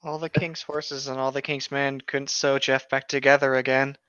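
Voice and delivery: usual speaking voice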